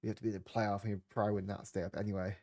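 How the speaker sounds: usual speaking voice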